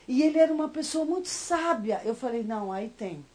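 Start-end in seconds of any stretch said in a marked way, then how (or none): none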